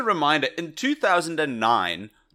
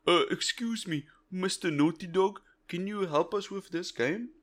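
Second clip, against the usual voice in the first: put-on timid, higher and softer American accent; 5 semitones higher, 6 dB quieter than usual. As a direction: ask politely in a mock American accent. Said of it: "Fantastic American accent, by the way".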